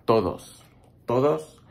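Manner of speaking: ordinary delivery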